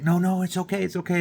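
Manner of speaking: imitating the dad. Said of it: "and he's like".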